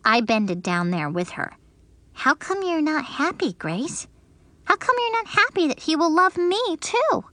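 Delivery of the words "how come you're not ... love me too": higher voice, innocent asking; puzzled little girl. Acted. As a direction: ask why she is not happy. Junie B asks Grace.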